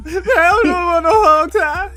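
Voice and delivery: high, loud, slow mock drawl through laughter; laughing impression. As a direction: imitate him while cracking up. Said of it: "She said".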